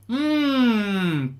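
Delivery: long falling "mmm" of relish; savouring hum. Pretend. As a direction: hum as if tasting something delicious. Pretends to savour rivals' tears.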